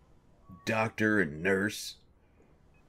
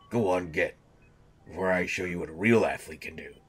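ordinary delivery

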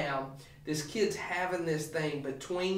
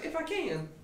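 much higher, astonished repeat; mock amazement; incredulous echo; 13 semitones higher than usual. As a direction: fling it back in disbelief. Jesus throws the words back.

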